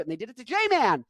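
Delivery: usual speaking voice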